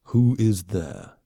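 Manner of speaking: slow, hushed alien voice; soft wary question. Acted. Warrior alien wakes and asks the shadows, slow hushed. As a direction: ask softly into the dark. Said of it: "he asked softly".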